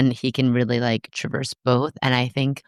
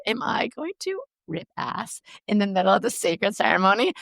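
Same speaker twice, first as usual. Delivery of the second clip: worried inner thought; 7 semitones higher than usual. Retold or imitated. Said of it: "having this really human thought of".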